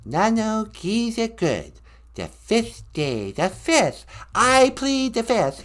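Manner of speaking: slow, high falsetto impersonation. Acted. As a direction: draw out each phrase like the pop star. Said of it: "I'm the king of pop".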